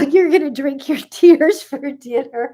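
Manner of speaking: reads the joke aloud, breaking into laughs; laughing while reading. Real, not acted. Host reading a viewer's joke comment, laughing through it. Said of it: "I'm sorry I'm laughing".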